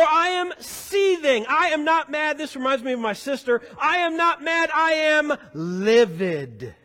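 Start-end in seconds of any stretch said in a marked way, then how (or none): 0.0-2.4 high, emphatic mock anger; acted seething. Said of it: "know how angry we are". 3.8-6.7 high start, slow drop on "livid"; hammed-up indignation, drawn out and falling; acted livid anger. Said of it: "know how angry we are".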